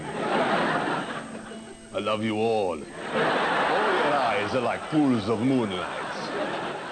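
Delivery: deep, slow seductive crooning; low hammed-up romantic come-on; mock Greek lover. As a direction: purr it like a cheesy Greek charmer. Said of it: "talk to them through a megaphone".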